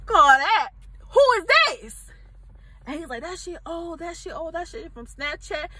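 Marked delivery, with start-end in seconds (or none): 0.1-2.0 much higher, louder shout; angry shout. Retold or imitated. Confronting, peak of anger. 3.2-5.7 much softer, sheepish mumbling; a caught boyfriend fumbling for words; mumbled excuse. Retold or imitated.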